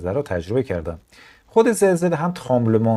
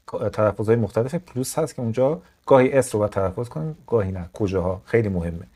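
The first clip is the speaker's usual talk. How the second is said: ordinary talk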